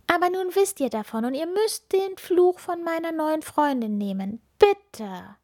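put-on very high, bright child voice; little witch girl. A narrator's reading. Little witch Ilvi introducing herself to the hermit, high child voice. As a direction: play a small eager witch girl.

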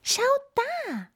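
very high mouse squeak; tiny high-pitched cartoon mouse; squeaky mouse. A narrator's reading. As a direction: pipe up like an excited little mouse. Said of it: "piepste die Maus".

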